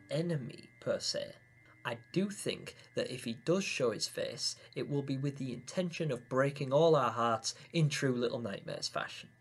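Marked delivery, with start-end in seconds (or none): none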